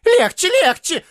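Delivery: very high, wailing plea; plaintive voice. A narrator's reading. Neighbour calling from another room, tearful plea. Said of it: "плачущим голосом".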